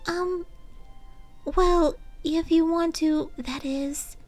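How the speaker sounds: high, rising panicked shy voice; flustered rambling. Acted. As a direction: babble nervously, climbing into panic. Fluttershy flustered backpedalling.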